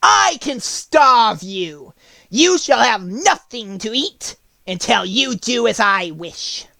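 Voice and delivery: high, loud, very slow witch threat; witch threatening. A narrator's reading. Witch warning the caged lion, slow cruel menace. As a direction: savour every word of the threat. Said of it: "said the witch to the lion".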